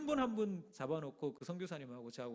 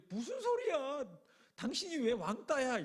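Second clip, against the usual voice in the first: high, shocked outcry from the ladies; 흥분한 항의; 8 semitones higher, 3 dB louder, slower than usual. Retold or imitated. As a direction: squeal it like a startled protest. 권사님들이 따지며 외침. (놀라서 높게 따지는 목소리)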